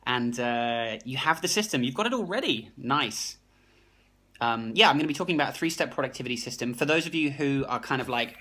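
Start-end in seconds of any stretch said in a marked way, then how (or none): 4.9-6.7 rushed, fast patter; hurried livestream intro; talking too fast. Real, not acted. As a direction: rattle it off too quickly. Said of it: "Sorry, I talk too fast".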